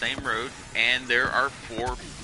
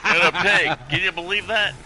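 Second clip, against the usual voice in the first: loud, higher, badly faked New York accent; poor New York accent; 5 semitones higher, 6 dB louder than usual. Incredulous agent tells colleagues about pig, poor New York accent. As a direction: exclaim in an exaggerated, clumsy New York accent. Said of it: "that's a very poor New York accent".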